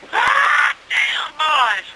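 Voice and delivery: hollering in pain. Retold or imitated. Old Billy hollering at the boys, stung by bees. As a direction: yell it like bees are stinging you. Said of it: "He got to hollering".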